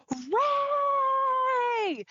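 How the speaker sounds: high, delighted squeal of a name; a little girl calling out with joy; excited child's shout. Retold or imitated.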